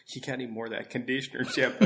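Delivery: laughing through a quick retold question; quoting her while laughing. Pantry volunteer retelling her request, laughing.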